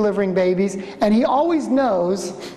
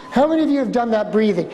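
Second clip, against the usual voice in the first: ordinary delivery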